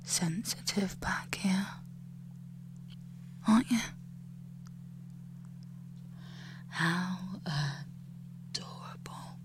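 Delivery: soft, close whispered taunts; menacing whisper. Acted. Captor whispering cruel taunts into the captive's ear.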